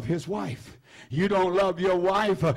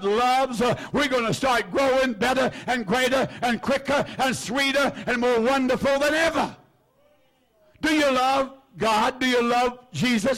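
higher, driving preacher's call; fervent preaching; 4 semitones higher than usual. Real, not acted. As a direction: press the congregation with questions. Rising preaching, call and response.

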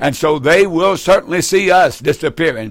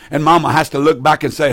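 normal talking voice

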